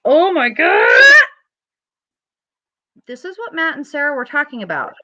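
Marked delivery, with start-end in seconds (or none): 0.0-0.9 dismay. Retold or imitated. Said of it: "and you're like".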